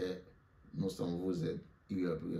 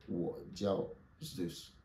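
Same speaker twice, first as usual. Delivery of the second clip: slow and a bit softer; slow model phrase; 5 dB quieter, much slower than usual. Shown as a demonstration. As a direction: model it slowly for the class. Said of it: "Et moi, je dis". (lentement, un peu plus doux)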